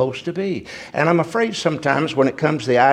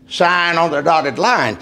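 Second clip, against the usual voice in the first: quoting the brother; slower than usual. Retold or imitated. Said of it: "And he said it like this".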